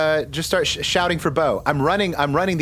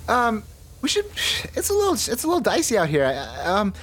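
high fast character voice; 9 semitones higher than usual. Acted. Vern in character.

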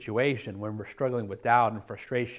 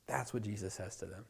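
ordinary talk, nothing marked

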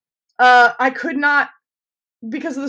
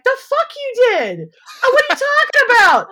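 mock disbelief; 14 semitones higher, 5 dB louder than usual. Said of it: "I'm like".

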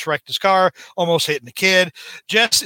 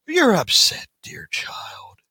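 hushed villain reply; 8 semitones higher, slower than usual. Acted. Norman's cold reply.

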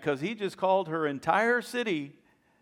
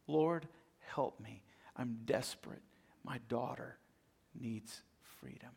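kneeling and pleading, voice sinking away; desperate plea; 6 semitones lower, 11 dB quieter than usual. Retold or imitated. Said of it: "She cried out as she knelt before him".

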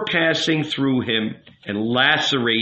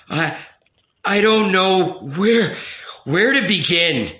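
halting, higher, breath-caught start; gasping; 7 semitones higher, 4 dB louder than usual. A narrator's reading. Youth trying to begin a confession, gasping. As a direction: struggle to get the words out. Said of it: "breathing rapidly, gasping".

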